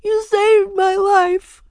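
high, loud, slow tearful wail; crying harder. A narrator's reading. Ramona thanking absent Jeremy, loud crying wail. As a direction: sob out gratitude. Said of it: "began to cry harder".

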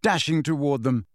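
plain reading voice

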